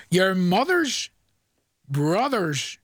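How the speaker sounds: "Your mother's" drawn out very slowly; slowed right down. Shown as a demonstration. Host repeats "Your mother's" slowly as the caller asked. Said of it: "say that again slowly".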